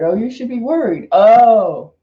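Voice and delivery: ordinary talk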